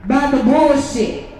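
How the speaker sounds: fervent rally speech, slow and loud; preaching. Real, not acted. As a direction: preach it to the crowd.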